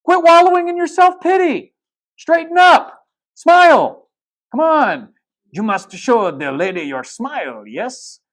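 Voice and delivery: very high, loud motherly teapot voice coaxing him; a bossy, caring mother figure scolding then coaxing; Mrs Potts voice. Retold or imitated. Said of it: "somebody come along and say".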